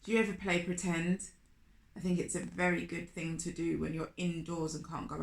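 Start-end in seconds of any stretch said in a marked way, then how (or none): none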